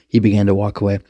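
usual speaking voice

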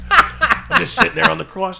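normal talking voice

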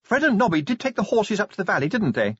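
high-pitched anxious lady; Sybil's voice. A narrator's reading. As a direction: keep Sybil up high and brisk. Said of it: "said Sybil".

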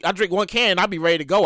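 normal talking voice

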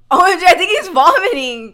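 loud laughing alarm; playful panic; mock alarm.

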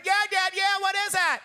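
put-on high, loud eager girls; mock girlish excitement; excited sisters. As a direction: squeal eagerly like little sisters.

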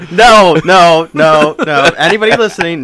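loud, high string of protests; emphatic refusal. Real, not acted. To any listeners after the hamster joke, loud protest. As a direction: shut the joke down at full volume.

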